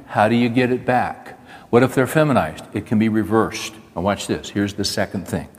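ordinary talk

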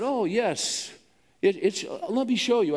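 a hint of a congenial street guide offering help; polite stranger. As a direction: lightly play the overly friendly local guide. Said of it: "very polite, very congenial".

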